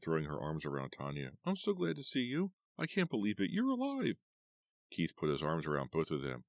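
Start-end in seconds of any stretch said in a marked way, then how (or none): 1.4-4.2 high, excited rush of relief; overjoyed. A narrator's reading. Eva hugging Tanya, high overjoyed voice. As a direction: gush with disbelief and joy.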